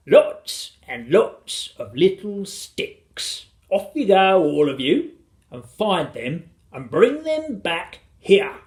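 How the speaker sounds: drawn-out, hearty command; father giving orders. A narrator's reading. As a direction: send them off with gusto.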